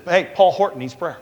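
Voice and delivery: calling out the name for prayer. Retold or imitated. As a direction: shout the name to the whole room.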